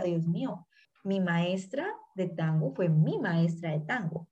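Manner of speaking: soft, amazed murmur; hushed awe. Retold or imitated. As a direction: breathe it out in quiet wonder. Recalling her teacher in awe.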